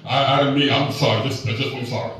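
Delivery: low, hesitant fatherly voice, voiced by son; a lightly voiced old dad, apologizing awkwardly; father's halting apology. Son retelling his dad's stumbling apology, low halting father voice.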